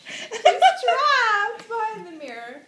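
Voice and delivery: loud, high, slow through real laughter; laughing exclamation. Real, not acted. Storyteller repeating Kate Winslet's plight, laughing loudly. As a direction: crack up while shouting it out. Teller repeats line, cracking up.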